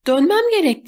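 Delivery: high-pitched alarmed cry; shouting. A narrator's reading. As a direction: call it out urgently, pitched high. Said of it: "diye bağırdım". (tiz sesle, telaşla bağırarak)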